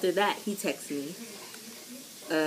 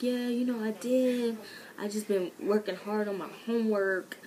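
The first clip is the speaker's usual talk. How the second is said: higher-pitched, mock-innocent excuse; bad liar's excuse; 5 semitones higher than usual. Student retelling her excuse for not texting back, unconvincing. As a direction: offer a feeble fib in a raised voice. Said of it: "I'm not good with lying".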